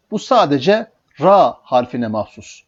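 normal talking voice

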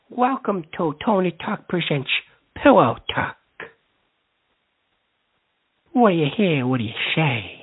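low-pitched announcer delivery; show host intro. Acted. Host opens Pillow Talk for callers, low announcer voice. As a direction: introduce the segment in a deep, drawn-down voice.

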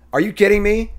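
exasperated disbelief. Real, not acted. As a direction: push the disbelief further. Repeated disbelief.

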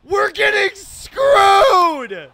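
slow, high, loud wail; anguished yell. Real, not acted. Gamer losing on the mound, wailing shout. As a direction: howl it in frustration.

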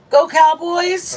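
excited cheer. Real, not acted. Cheering for the team.

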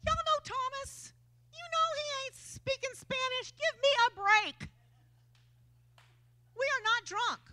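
a lightly voiced, exasperated apostle; exasperated Peter. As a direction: brush off the drunk accusation in disbelief. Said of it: "he was like".

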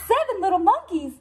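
high, fast astonished gasp; a mom thrown by too many kids; gasping Mama. Acted. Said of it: "gasps Mama".

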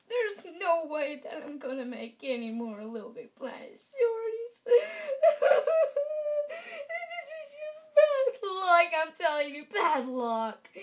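high, wailing hammed-up sob; mock crying. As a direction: blubber it out like a cartoon crybaby. Character wailing about bad luck.

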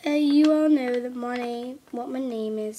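usual speaking voice

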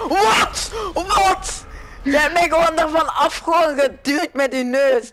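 high outraged protest. Real, not acted. Outraged at being pushed.

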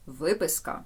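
ordinary delivery

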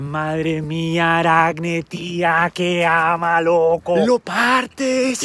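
high, slow, hyped youth slang; a crowd cheering Aracne's amazing weaving; amazed crowd. Acted. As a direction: gush like a star-struck teenager. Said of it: "en plan".

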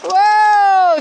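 loud, high startled whoa; startled by the splash; sudden loud whoa; surprised whoa. Real, not acted. Dog splashes them.

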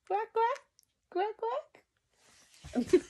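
high, lightly voiced quack quack; playful high duck imitation. Parent imitating duck for toddler.

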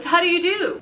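loud, high greeting. A narrator's reading. Father loudly introduces himself to Rita. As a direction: call it out loud and bright. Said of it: "in a much louder voice".

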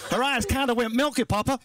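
high-pitched rural American confession; simple farm son. Acted. Farm boy confesses to his papa, high and simple. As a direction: play the guileless farmhand pleading.

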